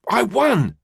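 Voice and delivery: put-on high, loud child's cheer of victory; jubilant cry. A narrator's reading. Boy cheering after mum gives up, jubilant high cry. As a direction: crow it like a winner. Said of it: "threw his arms up in the air in jubilation".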